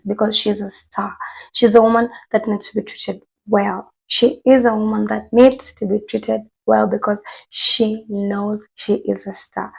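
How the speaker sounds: ordinary delivery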